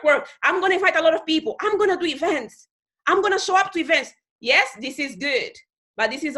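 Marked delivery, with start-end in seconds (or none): 0.4-4.1 higher, fast mock go-getter; imitated eager worker. Mimicking people who just do the skills, mock-eager. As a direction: rattle off plans like a keen recruit.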